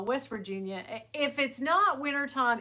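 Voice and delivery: normal talking voice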